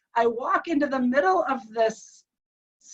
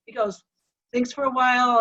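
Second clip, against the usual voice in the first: ordinary talk, nothing marked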